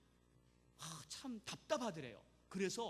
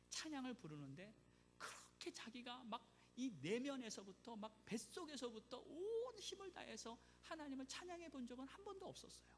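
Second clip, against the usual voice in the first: ordinary delivery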